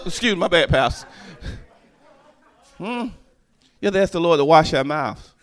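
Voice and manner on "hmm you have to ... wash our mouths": ordinary talk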